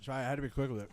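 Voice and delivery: chewing while talking; mouth full. Real, not acted. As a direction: talk through a mouthful. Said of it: "more food in your mouth next time".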